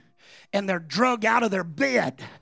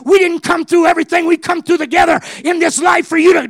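desperate shouting, much higher and louder; screaming at brother; 8 semitones higher, 13 dB louder than usual. Retold or imitated. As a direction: yell to keep him alive. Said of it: "started screaming at him".

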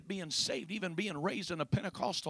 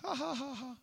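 imitated laugh; 9 semitones higher than usual. Preacher imitating people amused by sin, mock laughing. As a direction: put on a light, high "ha ha". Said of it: "You laugh and".

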